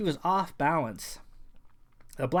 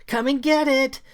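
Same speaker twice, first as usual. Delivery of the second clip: much higher, louder creature snarl; snarled taunt; 11 semitones higher, 12 dB louder than usual. Acted. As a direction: snarl it like a wild thing daring him. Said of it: "she snarled".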